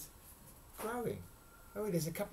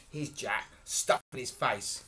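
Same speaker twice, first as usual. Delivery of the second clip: usual speaking voice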